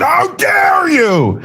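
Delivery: high, slow mocking shriek of outrage. Mocking The View hosts, a high slow outraged squeal. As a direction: drag it out in a shrill mock scandalized squeal. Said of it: "they said".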